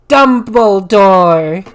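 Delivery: slow, loud drawn-out name; dramatic reveal. Acted. Revealing the intruder, slow and loud.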